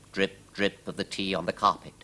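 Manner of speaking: usual speaking voice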